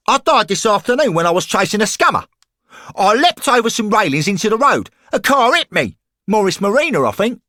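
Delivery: high, loud and fast lairy thug; football hooligan voice. Acted. Lamper tells Henry the match went wrong, rowdy hooligan voice. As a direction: bark it like a rowdy terrace lad.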